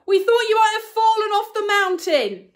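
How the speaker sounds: high, loud, flat-toned call; matter-of-fact man calling far off. Acted. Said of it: "his usual matter-of-fact tone".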